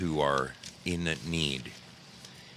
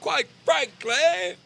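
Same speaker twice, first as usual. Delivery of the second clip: much higher, slow, louder put-on voice for Quite frankly; Linda's catchphrase; 5 dB louder, much slower than usual. Host imitating Linda's parachute video, high and slow. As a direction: squeal Quite frankly like a little firecracker parachuting. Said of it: "she says".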